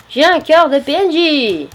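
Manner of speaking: high, drawn-out gloating cry; gleeful triumph. Pretend. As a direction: crow slowly over your prize. Triumphant over a looted villager heart. (cri de triomphe amusé)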